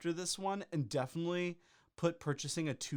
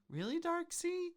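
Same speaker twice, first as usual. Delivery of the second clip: high incredulous disbelief; 5 semitones higher than usual. Real, not acted. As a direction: say the name in sheer disbelief. Mocking reviewer name.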